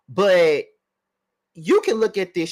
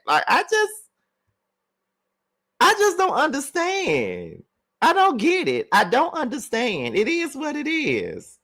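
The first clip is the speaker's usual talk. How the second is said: exasperated disbelief; 9 semitones higher than usual. Real, not acted. Exasperated at her British voice.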